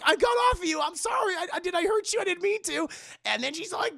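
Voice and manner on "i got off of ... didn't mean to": put-on high-pitched, frantic boyfriend voice; mock panicked apology. John apologises to screaming Ellie, high mock panic. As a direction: plead in a hammed-up high panic.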